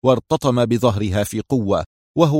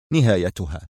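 plain reading voice

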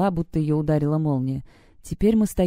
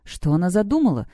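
usual speaking voice

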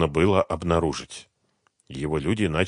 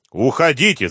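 raised, urgent shout; shouted order; 7 semitones higher, 6 dB louder than usual. A narrator's reading. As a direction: call out to make them flee. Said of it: "закричал быстро поднявшийся старший погонщик". (кричит, торопя)

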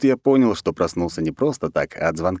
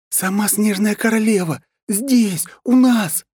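much higher, rising exclamation; exasperated outburst; 4 dB louder, slower than usual. Acted. Anna rolls her eyes about the Snow Queen coming, exasperated high outburst. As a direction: roll your eyes and exclaim. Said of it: "закатила глаза Анна".

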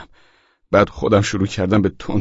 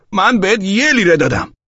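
high, raised quarrelling voice; indignant protest, pitched up; angry insisting; 10 semitones higher, 4 dB louder than usual. A narrator's reading. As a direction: insist hotly in a money argument. Acted quarrel over change.

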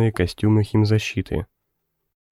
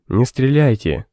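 shouting; 3 semitones higher, 3 dB louder than usual. A narrator's reading. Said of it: "закричал Митяй".

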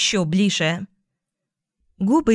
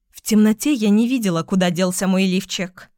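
ordinary delivery

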